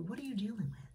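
ordinary talk, nothing marked

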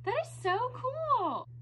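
high, drawn-out delight; delighted awe. Real, not acted. Painter admiring the finished pour, delighted awe. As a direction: swoop high and stretch it out.

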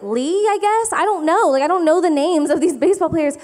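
high-pitched confused wife; high exasperated reply. Retold or imitated. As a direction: shrug it off in a high, baffled voice. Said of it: "And I was like".

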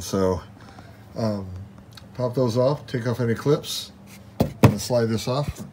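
ordinary delivery

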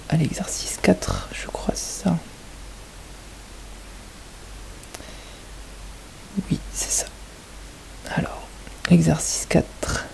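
whispered to herself while checking the exercise; a quick whisper, then back to the class; whispered aside. Real, not acted.

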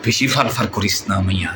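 ordinary talk, nothing marked

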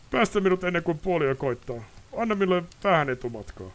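high, anguished nobleman begging; desperate pleading. Acted. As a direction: plead for an answer. Ostrid begging release.

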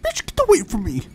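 high, loud screech at a stranger; yelled insult. Acted. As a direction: shove them off with a shriek. Shoving off a stranger in game.

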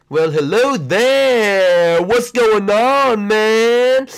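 slow, high, loud cocky bro; high loud slow swagger; Raptor skin voice. Acted. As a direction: drawl it out like a smug dino skin.

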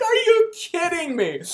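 high, loud, slowed disbelief; can't believe this is happening; mock outrage at the register; incredulous. Disbelief after laughing.

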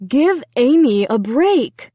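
slow, sharp fatherly rebuke. A narrator's reading. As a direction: put them in their place, slowly. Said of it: "Dad said sharply".

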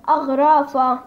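أغرافة read out loud and clear, each syllable shown; loud model reading of أغرافة; Coptic word demo. Shown as a demonstration. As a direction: teach the word أغرافة, projecting it. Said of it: "علينا كلمة اسمها كتاب".

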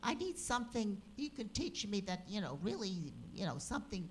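lightly voiced Brooklyn woman; a hint of her pleading tone; imitating a Brooklyn housewife asking for help; impression of Joyce. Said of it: "I'm going to sound exactly the way she sounded".

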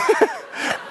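a very high, soft squeak of a laugh; a breathy squeal of amusement; squeaky laugh. Real, not acted. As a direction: giggle at the picture of the cow. Laughing before cow joke.

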